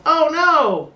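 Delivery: high, drawn-out alarm; a friend shouting that the owners are back; mock panicked yell. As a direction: sound the alarm like a caught partygoer. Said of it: "when he yells".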